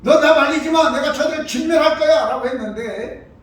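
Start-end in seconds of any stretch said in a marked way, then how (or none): 0.0-2.3 loud, high angry outburst; furious God. Retold or imitated. God raging at his people, loud furious shout. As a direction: roar it like an enraged God. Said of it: "하나님이 진노하세요".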